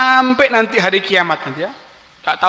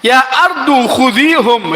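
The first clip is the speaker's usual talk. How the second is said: slow, heavy, louder call to the earth; furious command; 5 semitones higher, 3 dB louder, slower than usual. Acted. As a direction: let the rage take over the order. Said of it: "wis kadung ngamuk".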